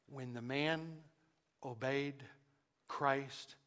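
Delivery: soft, word by word with long pauses; hushed, deliberate. Real, not acted. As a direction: let each word land quietly, slowing right down. Solemn key point of sermon.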